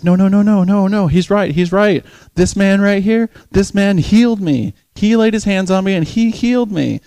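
high, loud, insistent voice; acted-out fervent defense; imitated urgent testimony. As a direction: plead his case to the crowd. Lady testifies, defending him to bystander.

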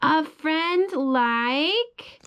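slow, drawn-out, rising on "you" with a catch in the voice; tender ending. Acted. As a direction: linger lovingly on the last line. Tender ending of bedtime book.

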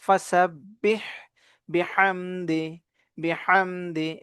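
slow "بحمد" with a strong throaty h; drawn-out "বিহামদি", h pressed from the throat. Shown as a demonstration. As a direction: squeeze the h in "فسبح" and "بحمد". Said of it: "হায়ের উচ্চারণ চাপ দিয়ে পড়ব".

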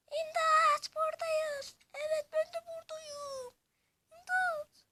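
high, loud squeaky villager calling out; high villager cries. Acted. Caged villagers calling to the heroes, shrieking.

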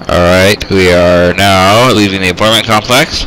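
loud pilot announcement; helicopter voice mode. Pretend. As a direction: call it out over the rotor noise. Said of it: "I shall talk in helicopter voice mode".

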